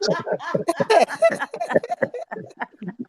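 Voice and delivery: high burst of laughter; hearty laugh. Real, not acted. Laughs at his own joke.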